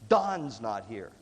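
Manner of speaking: low, very slow announcement; mock gravity. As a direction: imitate a solemn explanation, word by word. Supervisor explains the kingpin's absence.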